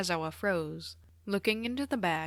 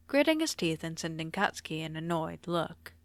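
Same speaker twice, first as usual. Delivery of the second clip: ordinary delivery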